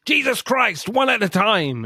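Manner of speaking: put-on loud, high exasperated yell over screaming girls; exasperated shout. Dad at a birthday party yelling at screaming girls, exasperated shout. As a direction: blurt it out at the top of your lungs, fed up. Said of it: "and he shouts".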